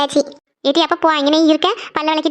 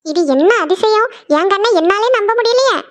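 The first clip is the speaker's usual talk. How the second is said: mock amazement; 5 semitones higher, slower than usual. Mother teases early riser in disbelief.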